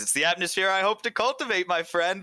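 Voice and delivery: high, jovial innkeeper voice; Glenn the innkeeper. Acted. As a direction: charm the guests into staying. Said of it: "he says".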